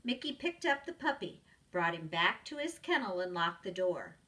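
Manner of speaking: ordinary delivery, nothing marked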